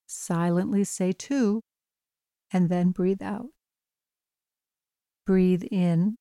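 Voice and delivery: calm, even bedtime meditation voice; guided meditation. Acted. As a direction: gently guide a listener toward sleep. Leads a bedtime breathing meditation.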